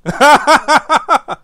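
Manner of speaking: bursting into a high, loud laugh. Real, not acted. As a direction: really crack up, loud and high. Laughing at a joke about Donald.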